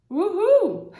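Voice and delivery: high, loud playful whoop; teacher cheers her students. Real, not acted. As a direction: celebrate with a bright whoop. Cheering after a tricky word.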